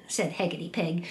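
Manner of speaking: plain reading voice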